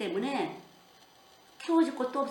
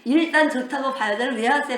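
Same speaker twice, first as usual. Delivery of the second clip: laughing, loud and higher; amused disbelief; 4 semitones higher, 9 dB louder than usual. Real, not acted. Preacher challenges a husband's lukewarm answer, laughing in disbelief. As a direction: burst out laughing as you question the answer.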